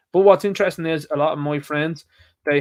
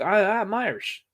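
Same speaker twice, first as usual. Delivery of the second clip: higher, slow mock Biden insisting; Joe doing Irish; 6 semitones higher, slower than usual. Mocking the American president claiming to be Irish: higher, slow put-on voice. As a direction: drag out "I'm Irish" like a doddery politician. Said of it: "He's like".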